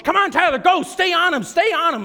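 imitated coach calling out; coach urging runner on. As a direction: urgently push your runner from the sideline. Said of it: "and there's my coach".

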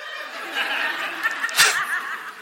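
high awkward burst of laughter. Real, not acted. Laughing awkwardly at the dad beside him, high-pitched.

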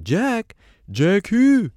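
very high puzzled British voice; confused, way up high. Retold or imitated.

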